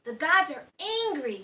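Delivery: loud alarmed cry; fearful shout; shouting captain. Acted. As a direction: warn the crew the gods are angry. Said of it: "shouted the captain".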